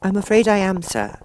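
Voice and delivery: put-on high girl's voice; a young girl, apologetic; Alice. A narrator's reading. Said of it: "said Alice".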